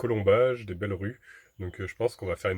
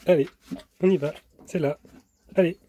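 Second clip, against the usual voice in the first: higher, louder coaxing; talking to a dog; 7 semitones higher, 4 dB louder than usual. Real, not acted. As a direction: urge the dog along. (encourager le chien)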